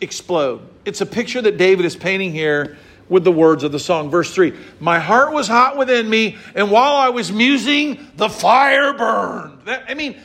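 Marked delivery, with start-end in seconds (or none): 4.8-9.6 scripture reading. Acted. Reading psalm verse.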